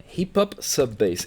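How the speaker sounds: "Hip Hop Sub Bass" said slowly, each word set apart; slow careful naming. Shown as a demonstration. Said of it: "mess with me in my French accent".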